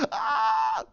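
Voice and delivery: high, held, breathy cry; mock scream. Villain about to be smashed by a rock, mock scream. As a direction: cry out high as the blow lands.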